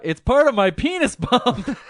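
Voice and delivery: loud high mock shout. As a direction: blurt it out loud. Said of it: "he's not gonna shout out".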